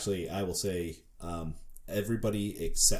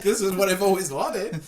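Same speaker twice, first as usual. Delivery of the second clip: mock thrilled mother; 9 semitones higher, 7 dB louder than usual. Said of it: "She's not like".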